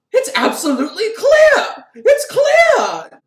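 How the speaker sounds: very high, loud frantic yelling; spastic excited shout. Retold or imitated. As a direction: hop about shrieking with manic glee. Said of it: "the original version ... more spastic version".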